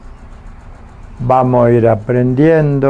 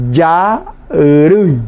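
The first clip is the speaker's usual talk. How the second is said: "Yarui" slowly, split into two drawn-out parts; syllable by syllable. Shown as a demonstration. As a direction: stretch "Yarui" out for dictation, ya, then rui. Dictates how the word is written. ("Yarui" dictado despacio, separando las sílabas)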